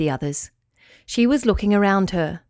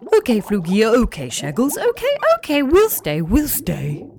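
fish agreeing; 2 semitones higher than usual. Acted.

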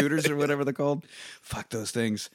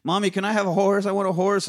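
put-on higher, pleading child; whiny kid voice; 6 semitones higher than usual. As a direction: beg like a spoiled kid. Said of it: "she's like".

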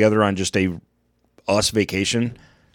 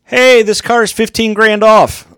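loud, slow, higher sales pitch; car salesman voice; 13 semitones higher, 7 dB louder, slower than usual. Retold or imitated. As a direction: sell it like a pushy car salesman.

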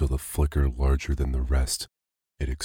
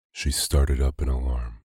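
plain reading voice

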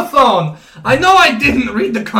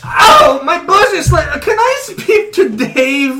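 whiny shout; 6 semitones higher, 6 dB louder, slower than usual. Acted. Crying out after slaps.

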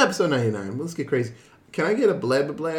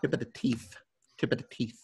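quiet diction drill; soft tongue-twister warm-up; vocal warm-up; 5 semitones lower, 10 dB quieter than usual. Shown as a demonstration. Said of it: "vocal warm-up".

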